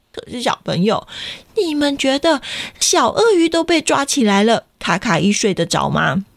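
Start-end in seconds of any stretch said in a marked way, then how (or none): none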